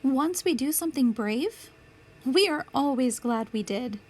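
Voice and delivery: usual speaking voice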